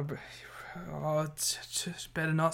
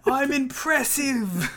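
high, drawn-out mock British voice; accidental British accent; 10 semitones higher, 4 dB louder, much slower than usual. Wrestler boasting before a match, high badly faked British accent. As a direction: let the Australian accent slip into British. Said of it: "I'm going British".

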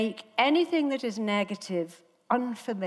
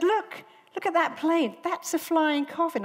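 high-pitched imitation of alarm; mock terrified; 9 semitones higher than usual. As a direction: play the panicky flyer pointing out danger. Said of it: "I'm terrified of flying".

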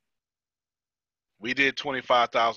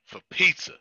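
PIZZA punched higher; emphatic repeat; 7 semitones higher than usual. Real, not acted. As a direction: lift and hit the word pizza.

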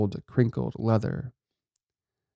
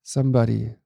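mild narrated whimper; a touch of a lost child's plea; a lost little girl's voice whimpering outside the car. A narrator's reading. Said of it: "it whimpered".

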